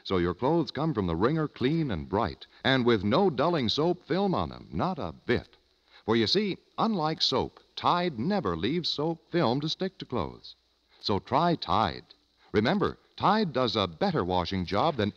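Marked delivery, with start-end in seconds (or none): none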